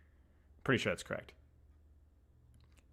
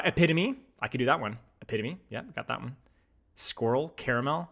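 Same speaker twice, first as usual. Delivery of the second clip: normal talking voice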